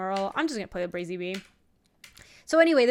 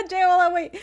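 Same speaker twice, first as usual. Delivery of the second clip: laughing; 8 semitones higher, 6 dB louder than usual. Real, not acted. Laughing at her teammate.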